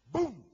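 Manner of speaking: loud mock impact; crash sound. Mimicking the crash of his failure, loud boom. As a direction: bang it out like a crash.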